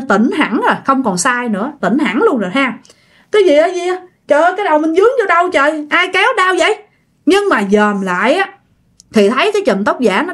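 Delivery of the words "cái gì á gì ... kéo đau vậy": higher, bewildered complaint of pain; startled, pained. Acted. Woman whose hair was yanked wondering who did it, startled and pained. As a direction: blurt it out higher, mildly alarmed. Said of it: "hoảng hồn, bạn đứng dậy".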